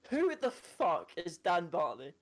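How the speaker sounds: slow, drawn-out offended demand; slow indignant punchline. Retold or imitated. Rejected man indignantly demanding who beat him, slow. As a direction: lean on who, slow and aggrieved. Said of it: "and he goes".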